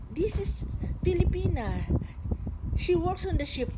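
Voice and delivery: driver introducing her. Retold or imitated. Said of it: "Sabi niya".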